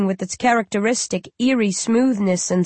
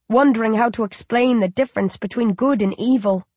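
ordinary delivery, nothing marked

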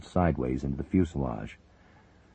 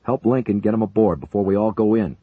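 loud, higher urgent order; 6 semitones higher, 7 dB louder than usual. A narrator's reading. Major ordering the co-pilot to help, loud. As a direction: hurry him along. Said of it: "Buchanan ordered".

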